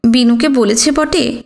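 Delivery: usual speaking voice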